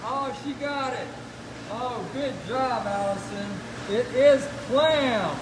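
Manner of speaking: ordinary delivery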